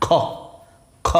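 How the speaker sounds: "খ" pronounced heavy, not the light everyday kha. Shown as a demonstration. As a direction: give "খ" a weighty kha sound for ক্ষিয়. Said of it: "উচ্চারণ হবে কীরকম? ভারী".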